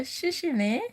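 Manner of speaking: high "Shishini" for xiexie ni, voice shifted; attempted Mandarin thanks; Chinese thank you. Shown as a demonstration. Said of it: "I will say".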